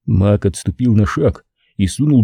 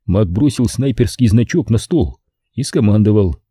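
plain reading voice

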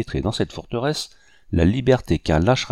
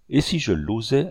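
usual speaking voice

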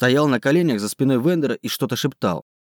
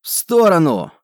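sharp urgent shout; yelling; 5 semitones higher, 5 dB louder than usual. A narrator's reading. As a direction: bark the warning. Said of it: "проорал Варфол".

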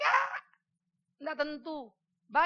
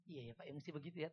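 mock meek agreement; 7 semitones lower, 16 dB quieter, faster than usual. Meniru jawaban pendeta gereja lain.

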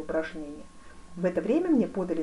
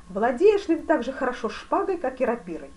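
великий князь; 6 semitones higher, 6 dB louder than usual. A narrator's reading.

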